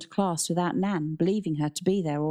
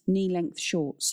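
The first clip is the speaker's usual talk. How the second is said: usual speaking voice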